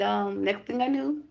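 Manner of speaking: ordinary delivery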